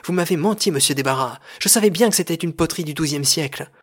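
loud, high acted indignation; indignant accusation. Paul accuses the director of lying.

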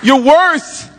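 high, loud, ringing pulpit delivery; full-force preaching. Acted. Pastor preaching to the congregation, at full force. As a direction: preach it out to the whole room.

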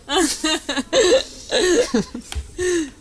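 long high laugh trailing down. Real, not acted. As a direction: narrator laughing at his own ending. Laughs at ending.

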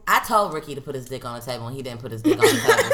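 fast, laughing through it. Real, not acted. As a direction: rush it out laughing. Laughing retelling.

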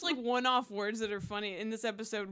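usual speaking voice

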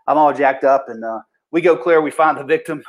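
ordinary talk

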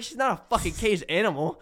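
half-hearted retold shout; retold yelling. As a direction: act out scolding a pushy friend. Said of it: "yelling at him".

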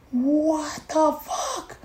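high, drawn-out, hushed amazement; stunned disbelief. Retold or imitated. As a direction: stretch each word slowly in stunned disbelief. Stunned at George directing him.

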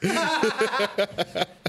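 high-pitched burst of laughing; real laughter. Real, not acted. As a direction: crack up at the joke. Cracking up at imagined scene.

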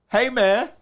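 loud, high-pitched accent impression of a friend; imitated Haitian-Yonkers accent. Veteran imitating his deployment buddy's greeting, Haitian-New York accent. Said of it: "the way he would fucking talk to me".

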